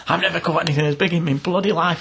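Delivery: put-on high, amazed old angler's voice; an old man marvelling at his catch; old man voiced. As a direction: play the thrilled old fella.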